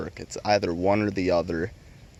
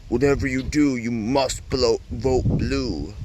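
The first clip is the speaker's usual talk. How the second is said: mock commenter; 3 semitones higher, 3 dB louder, slower than usual. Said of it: "This dude fucking comments".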